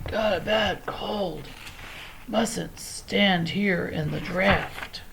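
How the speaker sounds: lion faking a lady's cold, soft and slow; faked sick voice. A narrator's reading. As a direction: pretend to be ill to get rid of them. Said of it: "You look odd".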